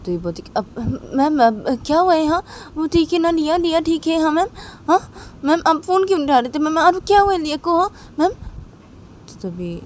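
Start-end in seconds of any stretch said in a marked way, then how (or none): 0.6-8.3 put-on high, loud child's voice, near tears; worried little girl. Little girl on the phone to madam, frightened and tearful. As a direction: rush the questions like a scared child.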